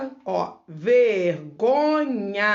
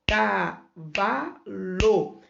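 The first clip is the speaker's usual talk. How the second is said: CAVALO very slow, ca... va... lo; split into syllables; much slower than usual. Shown as a demonstration. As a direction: say cavalo with a gap after each syllable. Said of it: "Minha boca emitiu três pedacinhos de som".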